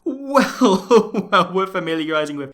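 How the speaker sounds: laughing. Real, not acted. Laughing at the cliche.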